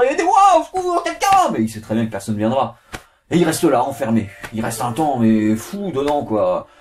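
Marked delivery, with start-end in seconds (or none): 0.0-1.5 high, loud panicked calling; desperate cry for help. Acted. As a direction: scream for help, trapped. Trapped man screaming for help.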